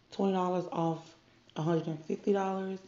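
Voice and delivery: ordinary delivery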